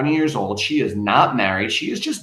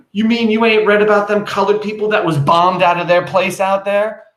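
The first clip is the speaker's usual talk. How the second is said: higher and louder, relishing bad news; dramatic catastrophe; 7 semitones higher, 5 dB louder than usual. Gossiping neighbor springs the bombing news, hammed-up alarm. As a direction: spread the disaster like juicy gossip. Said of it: "with the spirit of catastrophe".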